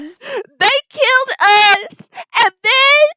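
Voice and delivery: very high, slow wail; hysterical accusation. Acted. Revived lady accusing her killers, hysterical wailing. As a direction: stretch it into a frantic, acted wail. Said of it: "And she says".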